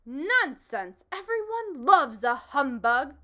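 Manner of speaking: loud, high blustering shout; pompous shout. Acted. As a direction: bellow it like a self-important humbug. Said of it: "shouted the humbug".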